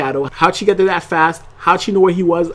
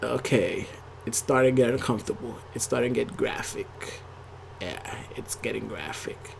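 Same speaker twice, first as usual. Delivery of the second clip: low, very quiet muttering to oneself; uneasy muttered reaction; 7 semitones lower, 14 dB quieter than usual. Retold or imitated. Viewer reacting to a graphic scene, uneasy low mutter. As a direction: voice sinking away, uncomfortable. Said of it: "I was sitting here like".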